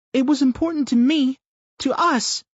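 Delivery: put-on high, loud little girl; an upset child insisting; child's voice. A narrator's reading. Daughter tells father it mattered, high upset child voice.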